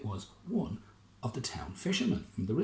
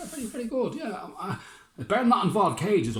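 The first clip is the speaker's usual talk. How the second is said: very high, wary mayor; fussy mayor; 10 semitones higher, 6 dB louder than usual. Acted. Mayor warning the general, very high. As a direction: squeak out a warning.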